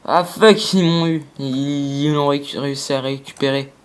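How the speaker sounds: usual speaking voice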